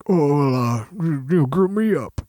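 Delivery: put-on drawling Texan dad; a loose take on a Texas propane dad; Hank Hill voice. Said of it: "He doesn't sound like that".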